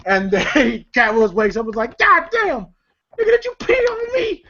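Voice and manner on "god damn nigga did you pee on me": high-pitched startled squawk; mock shocked yell. Cat Williams woken by thrown water, yelling in mock shock. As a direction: cry out in comic disgust, way up high.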